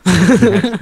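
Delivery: laughs out the word Next; bursting into laughter on Next; giggling through a word; laughing. Real, not acted. Laughing repeat of joke.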